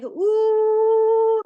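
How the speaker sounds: long, loud, high train "ooh"; imitated train whistle. Shown as a demonstration. Teacher shows a train entering the tunnel, imitated whistle.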